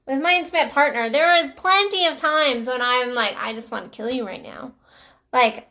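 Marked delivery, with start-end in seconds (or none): 3.4-4.7 quick, much softer aside; muttered exasperation. Retold or imitated. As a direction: mutter it fast under your breath. Retold exasperated thought about partner.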